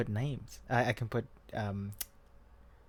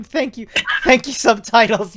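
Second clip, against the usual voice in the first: hammed-up thanks; 9 semitones higher, 12 dB louder than usual. Playful thanks to subtitles.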